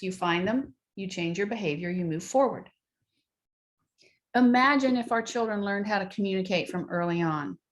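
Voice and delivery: normal talking voice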